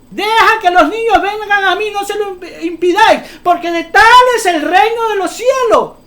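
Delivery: cita bíblica predicada, aguda y fuerte; proclamación a gritos. Real, not acted. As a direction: declama la Escritura a toda voz. (proclamando con fuerza)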